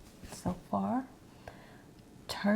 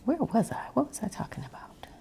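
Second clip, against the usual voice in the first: higher, quick whispered question; puzzled whisper; 6 semitones higher, faster than usual. Real, not acted. ASMR creator losing the thread after a digression, quick high whisper. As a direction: wonder aloud in a quick, high hush.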